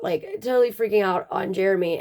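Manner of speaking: ordinary delivery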